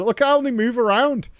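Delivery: ordinary delivery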